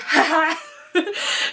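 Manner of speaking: bursting giggle; a bright high laugh; real giggle at the joke. Real, not acted. Laughs at own impression.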